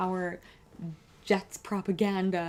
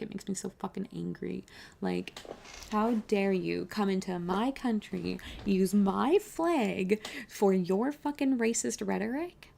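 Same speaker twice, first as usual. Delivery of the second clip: normal talking voice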